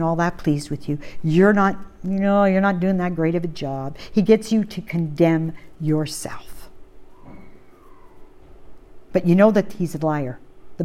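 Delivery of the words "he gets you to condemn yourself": soft, hushed whisper. Real, not acted. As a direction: breathe the line out in a whisper. Preacher drops to a whisper.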